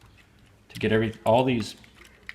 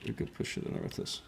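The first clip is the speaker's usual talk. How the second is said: whispering; 7 semitones lower, 15 dB quieter, faster than usual. Real, not acted. Fiddly fitting, whispered aside.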